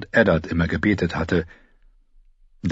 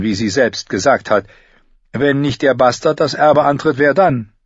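higher, reasoning objection; lightly voiced maester; 6 semitones higher, 4 dB louder than usual. A narrator's reading. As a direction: press the point with calm logic.

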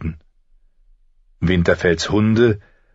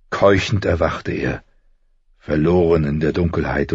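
usual speaking voice

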